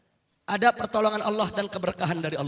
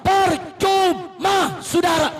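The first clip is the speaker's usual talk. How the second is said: loud, high preaching building to a shout; fiery sermon; 4 semitones higher, 5 dB louder than usual. Real, not acted. Preacher rousing the congregation, loud and rising. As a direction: preach with full fire to the crowd.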